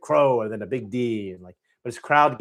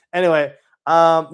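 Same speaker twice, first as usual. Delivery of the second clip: normal talking voice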